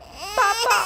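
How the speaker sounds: put-on high wailing little boy; crying child. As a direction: wail for a parent, high-pitched. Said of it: "Shyam चोर को देखकर रोने लगता है".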